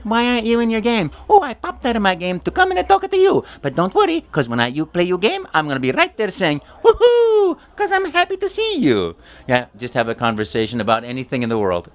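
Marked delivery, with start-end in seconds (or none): 0.0-1.1 put-on high child's voice. Children greeting Mario, high child's voice. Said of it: "And they say". 1.3-9.1 very high, louder cheerful plumber; Mario voice. Shown as a demonstration. Mario promising to cheer children on. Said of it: "Mario answers child".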